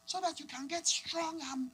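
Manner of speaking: high, soft, slow motherly coaxing; high-pitched, measured mum voice; African mom. Acted. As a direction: imitate an accented mother instructing her child. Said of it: "And the mom's like".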